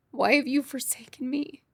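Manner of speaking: imitating a whispered plea to God. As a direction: whisper it like a desperate prayer. People praying, quoted plea.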